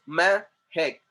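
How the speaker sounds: "Mehek" slowly, Me, pause, hek; two separate beats on "Mehek"; split into syllables. Shown as a demonstration. Teacher counting two syllables, "Mehek" split in two. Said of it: "there are two syllables".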